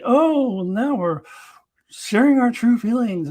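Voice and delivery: high, loud, hammed-up heartfelt gush; mock sitcom sincerity. Mocking cheesy sitcom characters, hammed-up high sincerity. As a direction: parody a cheesy sitcom confession. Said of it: "like the old sitcom thing".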